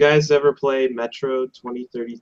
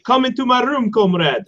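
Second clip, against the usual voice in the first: much higher, sultry mock Russian invitation; seductive prostitute; 11 semitones higher than usual. Game prostitute luring the player, mock Russian. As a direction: coax him in, hammed-up. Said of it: "She's like".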